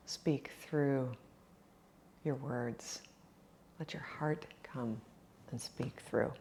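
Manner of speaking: hushed, near-whispered guidance with long pauses; guided meditation. Acted. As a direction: softly lead them into their breathing. Guides breath and body reflection.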